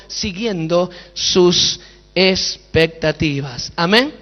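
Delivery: very slow, weighty, stretched words; slow emphatic resolve. Retold or imitated. Preacher telling God he will keep learning, slow emphatic vow. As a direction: draw out each key word like a vow.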